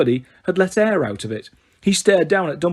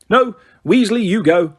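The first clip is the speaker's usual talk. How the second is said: louder, curt command; barked order; 6 semitones higher, 5 dB louder than usual. A narrator's reading. Fudge ordering Percy out, barked order. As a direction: bark it at the clerk. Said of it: "Fudge barked at Percy".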